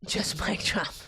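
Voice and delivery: unvoiced whisper with a laugh; whispered mic drop. Real, not acted. Streamer whispers a playful mic drop to chat. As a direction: breathe it out as a quiet joke.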